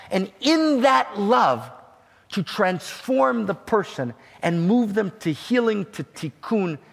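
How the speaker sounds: climactic preaching. Acted. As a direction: lean in and drive the point home slowly.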